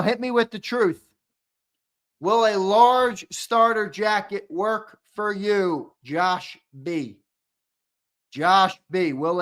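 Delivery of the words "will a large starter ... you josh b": a slow, careful question to the winner; slow, drawn out. Acted. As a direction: stretch every word out. Asking the winner about jacket size.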